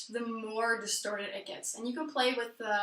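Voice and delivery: normal talking voice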